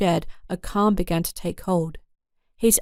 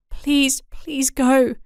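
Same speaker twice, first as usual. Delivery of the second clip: higher, urgent pleading; begging; 6 semitones higher, much slower than usual. Acted. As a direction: beg him to leave. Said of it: "Tressa begged".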